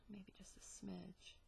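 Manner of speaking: soft unvoiced whisper. Real, not acted. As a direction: whisper it to yourself. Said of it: "Sorry about the whispering".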